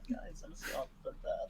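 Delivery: quiet, breathy mutter; muttering under breath. Acted. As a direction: mutter it to yourself. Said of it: "mutters that under her breath".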